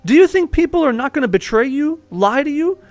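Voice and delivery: high, forceful tirade; heated rant. Real, not acted. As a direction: hammer the listener with hard truths. Heated rant on how harsh life is.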